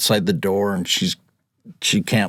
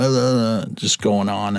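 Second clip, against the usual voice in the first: usual speaking voice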